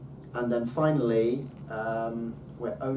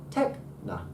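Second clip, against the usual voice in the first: TECH pitched up, NAH falling away; up then down; 4 semitones lower than usual. Shown as a demonstration. Teacher corrects the temptation to stress, up then down. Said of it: "supposed to be up and then down".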